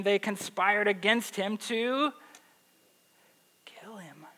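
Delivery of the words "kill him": whispered, letting the dark words land; hushed menace. A narrator's reading. Preacher reveals the brothers' murder plot to the congregation, hushed whisper. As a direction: drop to a near-silent whisper.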